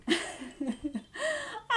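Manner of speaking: high, soft laugh; real laugh at her own story. Real, not acted. Teller laughing at the robber's weakness.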